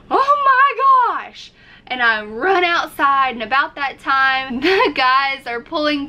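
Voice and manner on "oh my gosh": much higher, louder, slow panicked oh my gosh; shocked gasp. Retold or imitated. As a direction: gasp it out in horrified disbelief. Panicking at sewage smell outside.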